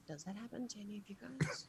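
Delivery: hushed, voiceless whisper; whispered aside. Acted. Player voices character's aside to friends.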